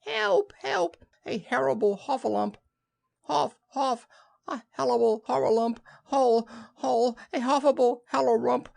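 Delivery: very high, frantic squeals; tiny piglet shrieking the alarm, words tumbling into muddle; squeaky panicked babble; Piglet crying out. Acted. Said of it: "still crying out".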